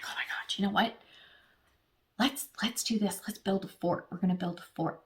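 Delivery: breathy hushed kid excitement, playfully imitated; excited kid whisper. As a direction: whisper like a kid hatching a secret plan. Kids secretly planning a fort.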